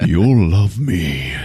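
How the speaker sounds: drawn-out, menacing killer voice; creepy killer. Retold or imitated. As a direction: stretch it out like a creepy stalker. Killer answering his victim, retold.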